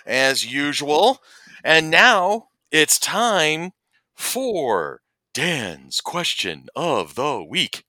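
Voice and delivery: slow, loud announcer intro. Pretend. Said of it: "Should put some reverb on that".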